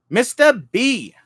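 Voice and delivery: high, loud, slow call of the name; scream. Real, not acted. Streamer screams a greeting to a chat viewer, high and loud. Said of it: "Sorry, I screamed".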